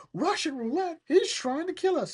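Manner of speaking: very high, incredulous squawk; high-pitched hammed-up outrage; mock alarm. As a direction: shriek it in pretend panic. Voices the alarmed guests.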